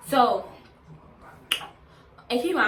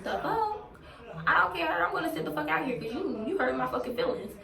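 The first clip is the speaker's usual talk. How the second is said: indignant outburst; 7 semitones higher than usual. Retold or imitated. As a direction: fire back, wounded and outraged. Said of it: "So I'm like".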